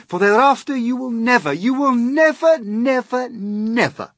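each "never" driven high and loud; loud, high run of "never", easing slightly on the last; emphatic repeated never. Acted. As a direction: hammer every "never" at a high, excited pitch. Showman's sales pitch building to emphasis.

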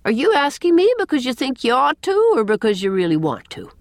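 pointed question, starting high and dropping; demanding. A narrator's reading. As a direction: demand a straight answer. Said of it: "demanded Miss Cornelia".

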